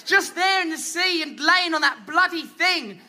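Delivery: normal talking voice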